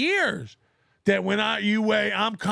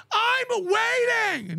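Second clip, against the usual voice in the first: higher, very slow taunting call; drawn-out taunt; 7 semitones higher, much slower than usual. Real, not acted. Taunting online critics who never follow through, slow and drawn out. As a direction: stretch it out to goad them.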